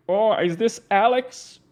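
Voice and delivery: peer at a jacket button in confusion; mock confused viewer.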